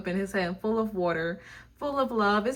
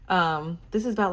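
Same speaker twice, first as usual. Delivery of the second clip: normal talking voice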